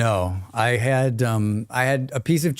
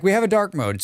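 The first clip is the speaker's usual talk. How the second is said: mock company; 7 semitones higher, faster than usual. Voicing company reply.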